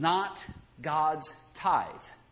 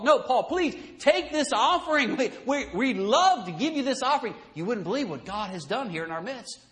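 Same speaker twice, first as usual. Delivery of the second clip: eager pleading; 6 semitones higher than usual. Retold or imitated. Macedonian churches begging Paul to take the offering. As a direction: beg him warmly to accept. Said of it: "with much entreaty".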